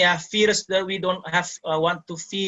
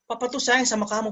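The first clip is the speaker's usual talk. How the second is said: fake loving dad; 7 semitones higher than usual. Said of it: "Sebenarnya itu marah".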